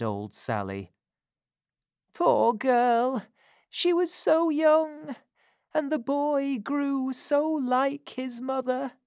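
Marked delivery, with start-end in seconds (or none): 2.1-8.9 put-on high old crone voice, confessing; an old woman unburdening a secret; dying old woman. A narrator's reading. Old Sally confessing to Missus Corney, high crone voice.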